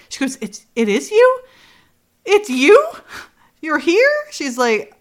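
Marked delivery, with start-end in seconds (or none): 0.4-4.1 high, rising wonder; amazed disbelief. Retold or imitated. Retelling her realising he is really here, amazed disbelief. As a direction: ask it high, barely believing. Acted reunion, she realises.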